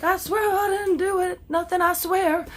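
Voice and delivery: high, pleading fake sob; hammed-up terrified denial; mock panicked pleading. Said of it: "They're like".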